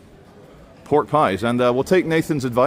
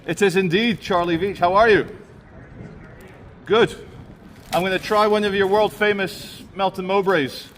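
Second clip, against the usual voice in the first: cheerful; 8 semitones higher than usual. Real, not acted. Greets and thanks the vendor.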